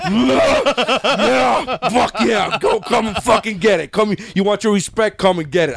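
high, whooping fight-fan roar through laughter; macho primitive hype. Acted. Fan's primal self cheering Rocky's dare, hyped-up macho. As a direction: cheer like a primitive male egging on a brawl. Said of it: "macho, fucking primitive fucking me that goes".

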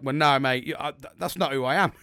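re-enacted firm denial; defiant retort. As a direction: push back hard at a mate. Rebuts friend saying he was scared.

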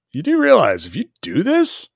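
warning Picard. Retold or imitated. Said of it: "Picard's like".

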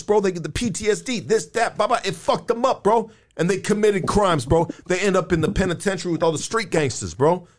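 ordinary talk, nothing marked

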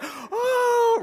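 surprised gasp. Retold or imitated. Crowd reacts to huge valuation.

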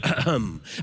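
dad's low, pointed ahem; warning throat-clear. Retold or imitated. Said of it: "through his small coughs".

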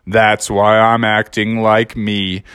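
low, slow, mopey donkey voice; gloomy Eeyore. Acted. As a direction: sigh it out like Eeyore. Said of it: "And Eeyore was like".

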